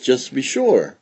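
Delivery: usual speaking voice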